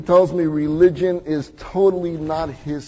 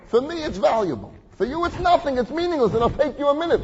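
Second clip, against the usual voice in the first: pleading rabbi; 10 semitones higher than usual. Retold or imitated.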